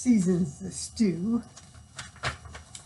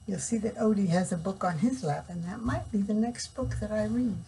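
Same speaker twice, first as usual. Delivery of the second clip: ordinary delivery, nothing marked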